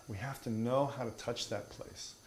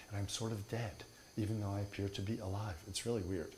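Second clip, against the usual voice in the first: mock spaced-out guru; 6 dB quieter than usual. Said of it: "now I talk like this".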